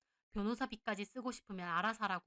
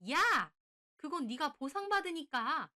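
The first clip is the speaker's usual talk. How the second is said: loud, high outburst; angry shout; 6 semitones higher, 7 dB louder, slower than usual. A narrator's reading. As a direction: yell "hey", then push back slowly. In-law shouts back in quarrel. (버럭 지르는 성난 목소리)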